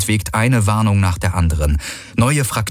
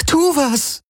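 desperate shout. Acted. Kirai yelling at Galen.

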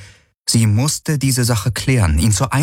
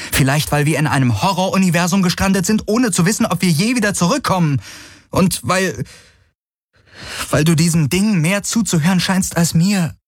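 pitched much higher, pleading and agitated; Kirai, upset and hurt; 11 semitones higher than usual. Acted. Kirai telling Galen he listens to the Helix more, pitched-up and agitated. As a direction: pour out her fear and jealousy in a rush, high.

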